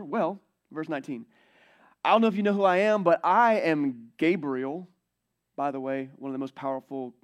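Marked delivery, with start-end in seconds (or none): 2.0-4.9 grand, louder angel, slowing on his name; mock-majestic archangel; Gabriel announcing himself. Pastor voices the angel Gabriel.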